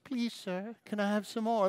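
high, soft begging orphan voice; pitiful orphan. Retold or imitated. Preacher mimicking a begging sky cap boy, high and pitiful. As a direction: plead up high like a hungry waif. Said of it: "like from Oliver Twist".

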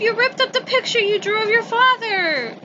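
high, upset, crying out; high distressed exclamation; dismayed cry. Acted. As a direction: cry out in shock and hurt. Character dismayed at torn picture.

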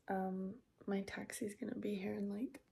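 early morning hushed whisper. Real, not acted. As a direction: whisper so nobody wakes.

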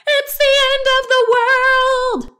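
high, very loud wail; panicked shout. A narrator's reading. Wife sure of an earthquake, high wailing shout. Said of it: "shouted Missus Watson".

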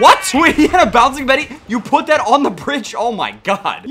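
can't believe the trap, laughing it out; laughing disbelief. Real, not acted. Player laughing at an enemy's mine on the bridge, stunned laughing disbelief.

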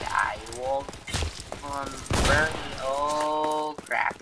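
ordinary talk, nothing marked

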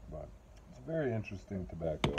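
talking around pipe stem; pipe in mouth. Real, not acted. Seen 3: Pipe stem held at or between lips while speaking.

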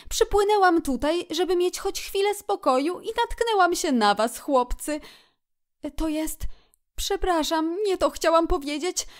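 high otter voice, halting into an apology; Otter, chatty then apologetic. A narrator's reading. Otter complains of crowds, then apologises to the friends. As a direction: blurt it out, then backtrack.

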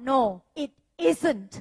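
mock blunt refusal, stressed; rude contradiction. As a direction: flatly contradict a polite greeting, firmly. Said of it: "มันดูหยาบคายอะค่ะ". (แกล้งตอบปฏิเสธแบบห้วน หยาบคาย)

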